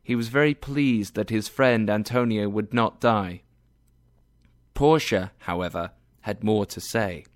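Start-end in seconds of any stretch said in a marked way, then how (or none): none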